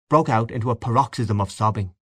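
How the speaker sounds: usual speaking voice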